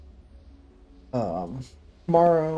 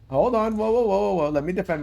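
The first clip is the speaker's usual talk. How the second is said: high, loud, rapid whoas to halt everyone; frantic "whoa" interruption; 8 semitones higher, 7 dB louder than usual. Real, not acted. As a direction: throw up the brakes on the conversation. Cutting in to stop the accusations.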